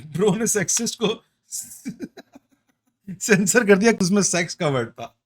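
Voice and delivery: laughing through the words, quick and high. Real, not acted. Co-host laughs at the censored word, quick and high. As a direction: crack up as you say it.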